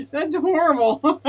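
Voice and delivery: slow words through real laughter; laughing it off. Real, not acted. Streamer to chat about the glitch, laughing. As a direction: laugh at the embarrassing sight.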